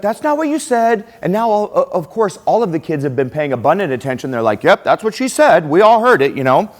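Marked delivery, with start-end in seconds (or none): 0.0-1.0 high-pitched mock panic; freaking-out kid. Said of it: "the kid starts freaking out". 4.6-6.7 high, loud imitation of kids piling on; mock chorus of insistent kids; classmates chiming in. Classmates chime in against the kid.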